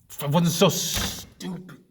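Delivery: loud, high burst of self-blame; loud outburst of regret; bitter self-reproach. Acted. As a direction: berate yourself, drawing out the words. Bitter self-blame at story's climax.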